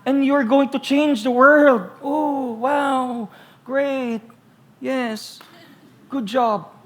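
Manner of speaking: high, sarcastic teasing of a lazy activist; sarcastic mockery. Preacher teasing congregation who won't wash dishes, sarcastic and high. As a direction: needle them with mock praise. Mocking the self-proclaimed crusader.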